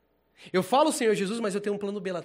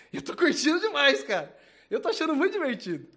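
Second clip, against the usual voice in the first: laughing with delight; 8 semitones higher, 5 dB louder than usual. Real, not acted. Laughing excited.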